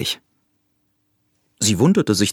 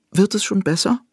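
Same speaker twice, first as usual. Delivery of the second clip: hohe, fürsorgliche Hasenfrage; besorgter Hase; 13 semitones higher than usual. A narrator's reading. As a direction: sanft und besorgt nachfragen. (der Hase fragt die verletzte Eidechse, hoch und fürsorglich)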